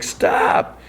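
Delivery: strained, voiceless mock cry; hammed-up anguished plea, held back in volume; imitated wail. Chris watching the beating, imitated strained wail. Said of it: "he wails".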